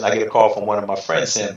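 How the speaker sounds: ordinary talk, nothing marked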